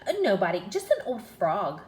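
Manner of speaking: loud, brushing it off; dismissive princess. Acted. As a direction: wave the question away. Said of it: "she said".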